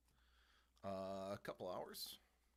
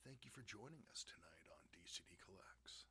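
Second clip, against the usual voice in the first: whisper; 5 semitones lower, 11 dB quieter than usual. Pretend. Whispered mock sign-off.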